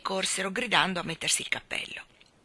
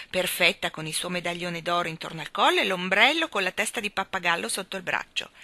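ordinary delivery, nothing marked

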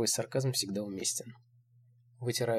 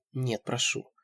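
small, understated plea; pleading; slower than usual. A narrator's reading. Narrator begs again, pleading. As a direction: beg briefly. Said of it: "Снова умоляю я".

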